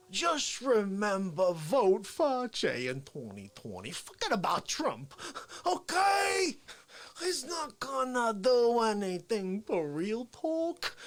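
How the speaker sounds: high, drawn-out parody voice; Dick Fauci. Acted. Signing off his show and heckling Trump, Fauci impression. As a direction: stretch the words out high and plaintive. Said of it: "And Fauci".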